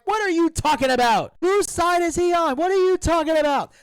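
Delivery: imitating the commentary booth, high and loud; mock commentators. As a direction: toss it back like a stunned announcer. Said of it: "They're like".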